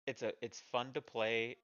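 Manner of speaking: normal talking voice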